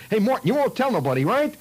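put-on high, loud boy's voice; coaxing kid. Flick coaxing Morton.

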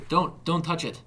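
high, loud warning; urgent shout. A narrator's reading. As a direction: shout it clearly to stop them. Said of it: "he shouted, much clearer now".

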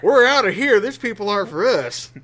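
high, loud mock walkout; imagined offended followers. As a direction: play indignant followers leaving, loudly. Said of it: "they're like".

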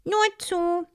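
high, bright go-ahead; a high, encouraging honey carrier. A narrator's reading. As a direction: urge her on cheerfully.

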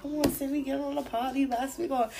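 friend urging party. Retold or imitated. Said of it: "She used to always be like".

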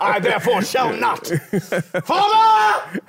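high, sing-song Shakespearean declaiming; renaissance fair olde speech. Pretend. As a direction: overact a stage tragedian. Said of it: "In that tone and everything".

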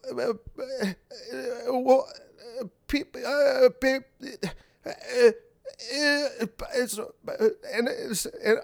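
mock old politician stammering, voice rising as he loses the thread; Biden impression. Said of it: "Is that a good Biden impression? ... the stammering".

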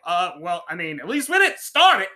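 loud, high, flustered mock reply; sheepish retold reply. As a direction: squeak out an awkward excuse. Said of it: "I'm just be like".

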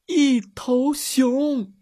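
high, slow, drawn-out fearful words; awestruck gasp. A narrator's reading. Friend points out the bear, slow fearful gasp. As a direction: let the terror stretch each word.

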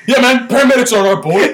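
high, loud bro-ish firefighter; hammed-up firefighter guy; firefighter impression. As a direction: play a rowdy firefighter bragging to his buddies. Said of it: "That's what all firefighters sound like".

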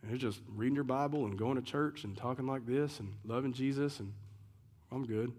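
lightly voiced smug churchgoer; mock complacent churchgoer. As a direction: brush it off like a comfortable churchgoer. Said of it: "Americans, even in the church, are like".

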